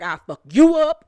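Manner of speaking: high, loud mimicked threat; mock threat. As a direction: blast the threat up high, imitating her.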